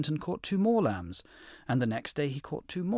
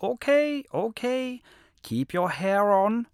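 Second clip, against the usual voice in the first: wolf voice; 10 semitones higher, 8 dB louder, slower than usual. A narrator's reading. Wolf placates the lion.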